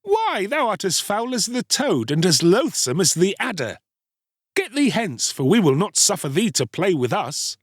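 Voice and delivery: put-on high-pitched children's taunt; mocking. A narrator's reading. Playmates driving the boy away, mocking. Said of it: "they mocked him and said".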